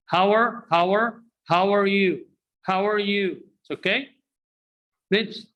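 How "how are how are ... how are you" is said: "How are" as one word, "hower", pitched up; "are" shrunk to R on the end of "How"; two words only. Shown as a demonstration. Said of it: "pronounced two words only".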